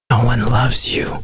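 hushed, creepy aside; mock secretive whisper. Whispering a secret to someone with nothing to hide, mock whisper. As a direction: softly whisper a cruel secret.

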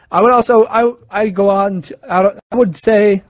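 tripping over the words, restarting; real stutter, words catching. Real, not acted. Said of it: "oh my gosh, I am stuttering so bad".

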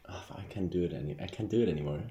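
ordinary delivery

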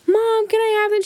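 put-on high little-kid request; pleading child. Child asking mom for a Barbie, high pleading.

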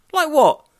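high, loud, mock-curious yelp; mock question. Imitated chat with the stall man.

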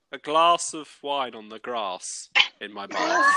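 slow, measured Southern English accent; drawn-out long vowels in Southern English. Shown as a demonstration. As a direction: say it slowly in a southern England accent. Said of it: "do Southern".

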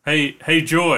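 ordinary talk

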